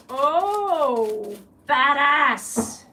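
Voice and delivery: loud, drawn-out admiring outburst; big loud reaction of admiration; impressed exclamation. Real, not acted. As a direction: belt it out, really impressed.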